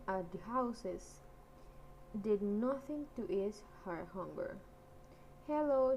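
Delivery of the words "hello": high, loud call into the clearing. A narrator's reading. Darling calls out to the empty houses, loud and high. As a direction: call out to find anyone. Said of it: "she called".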